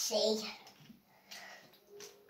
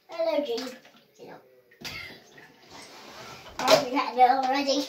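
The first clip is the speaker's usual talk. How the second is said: normal talking voice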